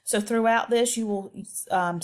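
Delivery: usual speaking voice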